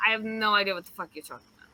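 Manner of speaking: rattled off fast and low; mock exasperated denial. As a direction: brush them off quickly. Girl denies knowing anything.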